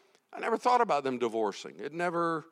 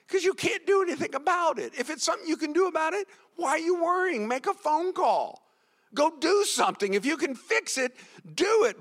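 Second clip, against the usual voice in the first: high-pitched, urgent exhortation; impassioned preaching; 11 semitones higher than usual. Real, not acted. Preacher telling worriers to go do something, urgent. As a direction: push them to act, emphatically.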